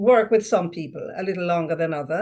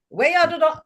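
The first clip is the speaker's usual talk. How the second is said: Jamaican lilt; 9 semitones higher, 4 dB louder than usual. Shown as a demonstration. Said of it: "That's what we say".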